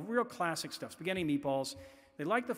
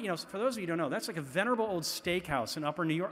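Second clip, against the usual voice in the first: ordinary talk, nothing marked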